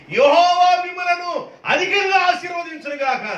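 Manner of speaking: high, ringing shouted blessing; proclaimed blessing. Acted. Balaam proclaiming a blessing over Israel, high and shouted.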